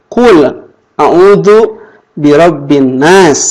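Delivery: drawn-out Quran recitation; slow recitation. Shown as a demonstration. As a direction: recite each word slowly and clearly. Teacher reciting verse slowly.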